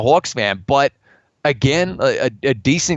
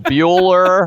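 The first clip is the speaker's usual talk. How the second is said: roll-call teacher; 3 semitones higher, 4 dB louder than usual. Pretend. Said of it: "doing roll call".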